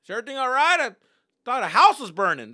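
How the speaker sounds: high, loud alarmed neighbour imitation. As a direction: call out in mock alarm. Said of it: "They're like".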